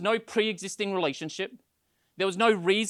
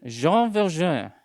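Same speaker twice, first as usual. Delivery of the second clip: slow, louder, drawn-out French accent; 5 dB louder, slower than usual. Shown as a demonstration. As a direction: savour the name in a playful French accent. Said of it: "do you want me to do a French accent?".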